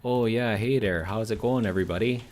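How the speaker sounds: thick, folksy Upper Peninsula Yooper accent. Shown as a demonstration. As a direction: greet everyone like a cheery Yooper. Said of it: "go with the Yooper accent".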